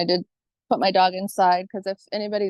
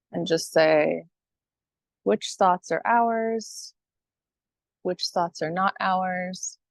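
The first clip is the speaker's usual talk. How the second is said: guided meditation. Acted. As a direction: guide listeners calmly, phrase by phrase, leaving space.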